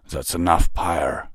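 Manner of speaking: restrained, firm reprimand; stern. A narrator's reading. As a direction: cut off a subordinate sternly. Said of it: "Vanguard said sternly".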